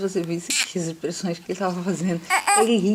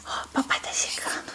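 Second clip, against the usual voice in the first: whisper; 8 dB quieter than usual. Real, not acted. Mother whispering to baby that dad is coming.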